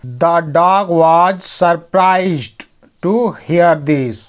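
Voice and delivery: very slow, word-by-word English; slow dictation pace; slow reading. Shown as a demonstration. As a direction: read each word out slowly for learners. Teacher reads story line aloud.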